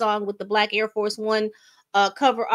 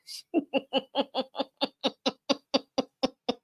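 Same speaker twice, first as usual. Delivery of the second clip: short laugh; 3 semitones higher, 6 dB quieter than usual. Real, not acted. Laughs at his own joke.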